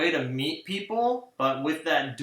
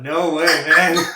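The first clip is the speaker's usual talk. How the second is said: louder and slower, a real protest; no way, drawn out and loud; loud laughing refusal; 2 semitones higher, 8 dB louder, slower than usual. Real, not acted. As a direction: push back hard, half laughing. Refusing to do an accent.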